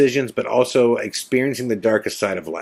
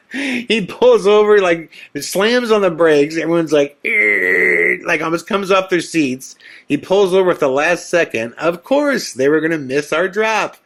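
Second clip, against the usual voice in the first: excited retelling; 6 semitones higher, 3 dB louder than usual. Real, not acted. Excited retelling after yell.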